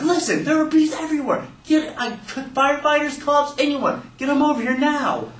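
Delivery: high-pitched, insistent mother voice; frantic mom. Acted. Mom grabbing the phone from her kid, frantic. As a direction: demand help urgently, pitched high.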